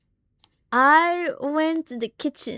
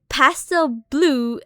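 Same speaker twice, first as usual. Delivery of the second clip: usual speaking voice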